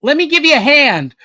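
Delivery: bouncy toon voice; cheeky cartoon rabbit. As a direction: toss off a gleeful pun. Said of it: "And he says".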